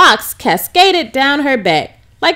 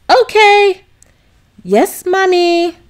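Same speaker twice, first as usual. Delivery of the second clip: put-on higher child's reply; lightly voiced little girl; 7 semitones higher, slower than usual. A narrator's reading. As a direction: answer sweetly like a small girl. Daughter voiced.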